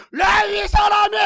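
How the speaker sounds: high, drawn-out roar; furious God. Acted. The Lord turns on Moses, furious shout. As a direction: explode on slow, stretched syllables. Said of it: "주님께서 모세에게 크게 노하시어".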